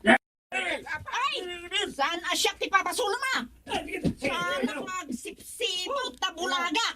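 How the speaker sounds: put-on high, hushed old woman fussing; old woman complaining. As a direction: grumble like a frail old lady.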